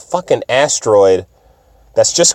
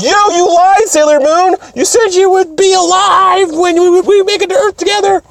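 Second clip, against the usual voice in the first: tearful accusing yell. Retold or imitated. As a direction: yell at her for breaking a promise. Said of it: "it's like".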